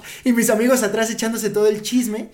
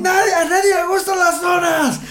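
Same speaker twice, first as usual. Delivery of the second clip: mock furious shout; 10 semitones higher, slower than usual. Podcaster acts hungry Marijo screaming at Johan over donuts. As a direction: yell like a starving monster.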